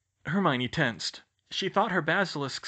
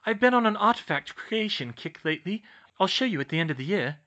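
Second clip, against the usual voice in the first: put-on high, louder girl's character voice; eagerly explain, pitched up; Hermione, keen explainer; 6 semitones higher, 5 dB louder than usual. Hermione explains her artifact kick to the twins, eagerly.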